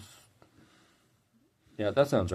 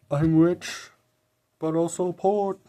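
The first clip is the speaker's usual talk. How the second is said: higher-pitched mock recital of lyrics; high, drawn-out parody of a lyric; mock lyric quote; 9 semitones higher, 4 dB louder, slower than usual. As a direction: quote it as silly, bad lyrics. Quoting bad lyrics mockingly.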